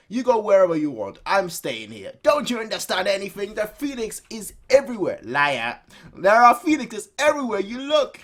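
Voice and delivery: heated quarrel. Acted. Nagi and the companion arguing about the Phoenix. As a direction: argue back hotly.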